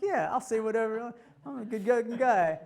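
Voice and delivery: cocky self-parody, high and loud; hammed-up self-satisfied boasting; arrogant, full of himself. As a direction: play your own swaggering younger self. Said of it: "I'm arrogant and I'm full of myself".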